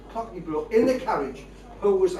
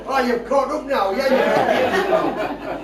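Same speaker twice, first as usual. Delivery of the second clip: laughing; 6 semitones higher, 7 dB louder, slower than usual. Real, not acted. Laughing at own joke.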